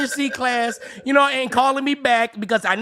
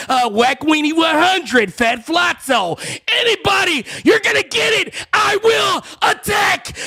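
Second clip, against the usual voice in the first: shouting; 6 semitones higher, 4 dB louder than usual. Real, not acted. Threatening rant.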